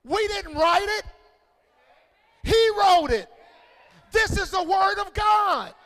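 high, shouted phrases with hard punches; full-force preaching. Real, not acted. Preacher urging church to lift up Bibles, shouted preaching. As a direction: shout it out to rouse the congregation.

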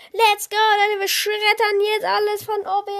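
loud, high excited call; hyped up. Real, not acted. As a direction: pump up the crowd. Hyped announcement. (laut und aufgedreht)

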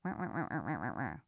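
mimed droning "wah-wah" of the inner voice; wah-wah nagging noise. Acted. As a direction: imitate nagging as wordless wah-wahs. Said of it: "hear the voice come in and say".

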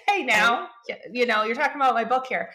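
jokingly protect your own book; mock defensive. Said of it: "was initially defense, being defensive".

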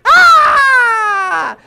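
long, very high loud scream; mock horrified scream. Woman hearing priests called liars, mock scream. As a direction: shriek it out and hold it.

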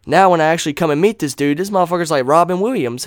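ordinary talk

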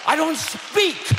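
impassioned pulpit declaration, key words stretched; full-force preaching. Real, not acted. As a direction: thunder it out with authority.

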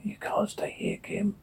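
very soft, voiceless whisper. Acted. Warning Kim that she cannot stay, whispered. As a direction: whisper it, barely any sound.

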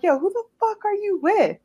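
high-pitched annoyed accusation; suspicious, irritated demand without shouting; pissed off. Retold or imitated. Girlfriend demanding who her boyfriend is with, pissed off. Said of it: "it pissed me off".

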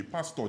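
"Pastor" ending on "-taw", R dropped. Shown as a demonstration. Nigerian explaining they remove the R, "Pastor" ending "-taw". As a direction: say "Pastor" without any R at the end. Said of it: "there's no R".

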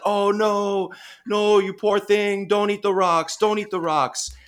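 high, mock Boston accent, the woman fretting at the seal; a lightly done Boston woman pleading, well above normal pitch; anxious Boston woman begging a seal, high mock accent; imitating a Boston accent. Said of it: "woman with a very thick Boston accent".

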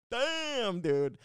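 very high, loud "Damn, dude" in mock shock; stunned reaction. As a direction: react amazed at her snub. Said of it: "I was like".

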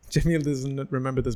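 ordinary talk, nothing marked

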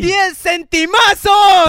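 loud, high, drawn-out hype; slow triumphant shout; mock-hype shout. Real, not acted. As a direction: stretch out centimazos like a jackpot. Hyping a tiny donation.

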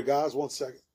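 speaking around a sip; quiet aside while drinking; while sipping a drink. Real, not acted. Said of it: "Let me take a sip here".

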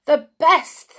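high, loud eager cry; witches crying out. A narrator's reading. Witches agreeing with their leader, loud high cry. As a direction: cry it out as a greedy crowd. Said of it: "they cried".

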